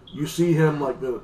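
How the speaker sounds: ordinary talk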